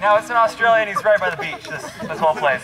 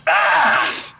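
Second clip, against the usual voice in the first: high, breath-rough acted scream; sudden pretend shriek; mock scream; 9 semitones higher than usual. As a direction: let out a hammed-up "Ah!". Acting out the woman's outburst.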